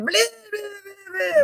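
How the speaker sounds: high, singsong blah blah like a stuck echo; imitating her own garbled echo; mock echo babble. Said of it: "It was like everything I said".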